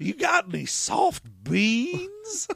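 put-on high slow toothless old-timer; old prospector. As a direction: beg for beans like a miner. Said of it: "old prospector".

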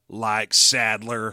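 low, drawn-out robot voice; robot reply. Acted. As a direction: answer very slowly in a deep robot voice. Acting the robot's slow reply.

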